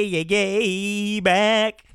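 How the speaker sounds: ordinary talk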